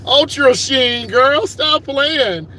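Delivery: high laughing cry of delight; laughing loudly. Real, not acted. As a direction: laugh it out loud and slow. Laughing at the memory.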